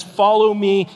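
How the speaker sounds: "Follow me" called out loud and high; ringing Follow me. Acted. Pastor voicing Jesus's call to the fishermen, ringing out. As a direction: proclaim "Follow me" like a summons. Quoting Jesus, louder than before.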